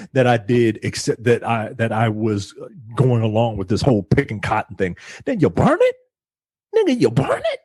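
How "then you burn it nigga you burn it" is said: high, disbelieving outcry; rising in outraged disbelief; incredulous. Real, not acted. Outraged at burning the cotton.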